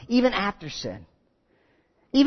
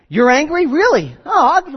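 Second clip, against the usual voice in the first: higher, louder incredulous retort; mock outrage; 6 semitones higher, 6 dB louder than usual. Imagined angry God to Cain, mock parental outrage. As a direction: pounce on it in disbelief.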